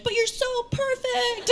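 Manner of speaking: very high adoring fan squeal; put-on audience flattery, high-pitched; mock gushing. Comic voicing audience protesting she's perfect, mock gushing.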